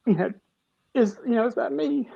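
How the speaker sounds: tearful, voice cracking up high; fighting back tears as the memory hits; voice breaking. Real, not acted. Recalling the colonoscopy screen, voice breaking with tears. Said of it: "sorry, I feel emotional".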